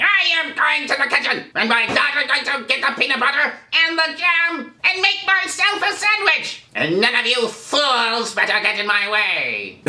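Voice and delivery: high, menacing theatrical villain, slowing down grandly; Skeletor in character. Shown as a demonstration. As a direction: turn a snack into a high-pitched villain's threat. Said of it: "that's how he would say it".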